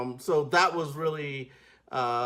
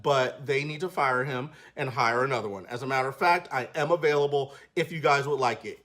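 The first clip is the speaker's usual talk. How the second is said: ordinary delivery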